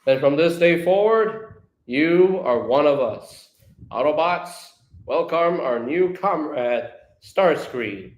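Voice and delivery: slow, proclaiming leader voice; heroic, stately declaration; Optimus Prime. Acted. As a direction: announce it to the troops with ceremony. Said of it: "Optimus Prime goes".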